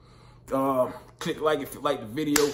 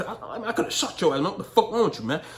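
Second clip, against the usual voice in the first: retold scolding; 6 semitones higher than usual. Scolding stranger.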